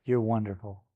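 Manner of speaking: ordinary talk, nothing marked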